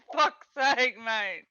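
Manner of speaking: loud, fed-up curse; exasperated outburst. Real, not acted. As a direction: blurt it out, done with it. Annoyed at loud phone callers.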